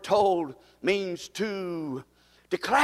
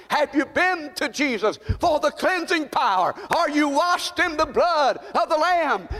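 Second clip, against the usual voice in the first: preaching at full force; 8 semitones higher than usual. Acted. Pastor rousing his congregation about the cleansing blood, full-force preaching. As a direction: drive every line home to the pews.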